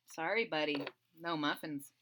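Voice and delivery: usual speaking voice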